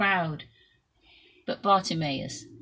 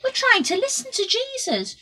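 high, loud telling-off; annoyed shout; 6 dB louder than usual. A narrator's reading. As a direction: shush him crossly. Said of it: "the people shouted back".

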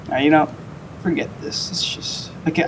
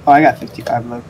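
ordinary talk, nothing marked

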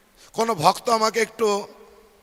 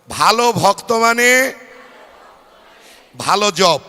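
louder, spelled-out call of the mantra; 6 dB louder than usual. Shown as a demonstration. Preacher urging the crowd to say it louder, loud call. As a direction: call it out for all to repeat. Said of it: "জোরে বলুন".

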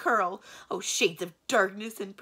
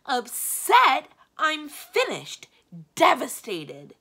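loud, high, melodramatic ranting; distraught outburst; 6 semitones higher, 12 dB louder, slower than usual. A narrator's reading. Magician laments old age to his secretary, distraught shouting. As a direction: rant in despair, hammering each disaster.

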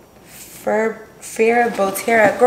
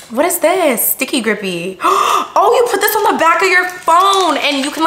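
ordinary talk

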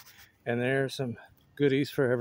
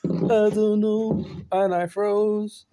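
slow mock daze; 6 semitones higher, 6 dB louder, much slower than usual. Real, not acted. Playful confusion, slowed and loud.

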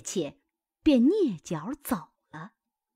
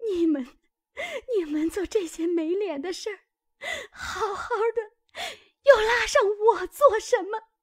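higher, tearful voice, rushing and breaking into a cry; dry sobbing protest; 12 semitones higher, 3 dB louder than usual. Acted. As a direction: choke it out through tears, getting louder. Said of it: "只气得干哭".

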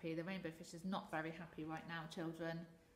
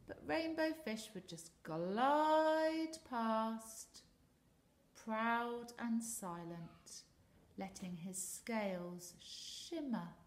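slow storytelling; 4 semitones higher, 5 dB louder, slower than usual. A narrator's reading. Reads proud glide slowly.

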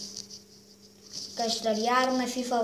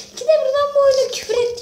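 much higher, louder, frantic complaint; high fast rant; 13 semitones higher, 9 dB louder, faster than usual. Real, not acted. Gamer rants at the game to viewers, high and fast.

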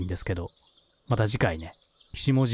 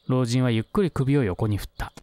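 ordinary delivery

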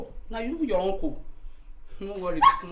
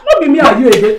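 loud confrontation; 7 semitones higher, 17 dB louder than usual. Acted. Jilted lover confronts him.

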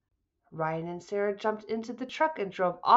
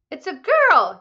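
high, loud joyful yell; new father's happy shout; 8 semitones higher, 10 dB louder than usual. Acted. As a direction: shout the news to everyone. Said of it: "yelled Ryan".

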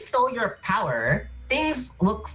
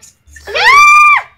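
shrill loud villain laugh; evil cackle; 16 dB louder than usual. Acted. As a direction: cackle triumphantly.

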